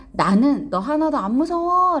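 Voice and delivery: loud, high defiant yell; acted fearless shout; storybook shout. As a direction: yell it bravely like the child in the story. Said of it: "소리 지르는 부분이 있어요".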